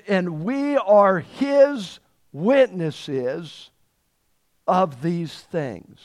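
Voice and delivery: drawn out, weight on are; slow emphatic declaration. Acted. Preacher voicing Peter's bold reply to the council, slow and emphatic. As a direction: stretch every word as Peter's bold reply.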